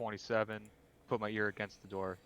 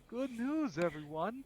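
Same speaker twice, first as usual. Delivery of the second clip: put-on lightly raised old professor voice; Futurama professor impression; 12 semitones higher, slower than usual. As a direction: start high, settle into an announcement. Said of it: "he does say it that way".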